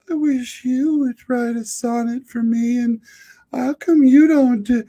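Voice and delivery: high, mock-dreamy wife voice; mimicking wife. Husband mimicking his wife's wish for a sonnet, high and pleading. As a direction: pitch up into a wistful wife. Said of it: "my wife will look over at me and go".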